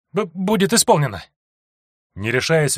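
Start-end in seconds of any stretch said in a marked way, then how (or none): 0.1-1.2 high, stammering scared reply; frightened stammer. A narrator's reading. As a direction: stutter out of fear. Terrified disciple stammers.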